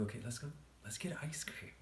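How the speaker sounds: high breathy whisper; giddy whisper. Retold or imitated. Giddy whisper in retold quote.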